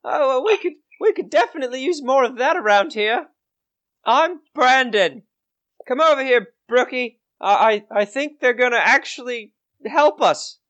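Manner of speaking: put-on high, eager young boy's voice; hopeful farm boy. Farm boy introducing himself to helpful adventurers, high and hopeful. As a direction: call little sister over in a boyish pitch.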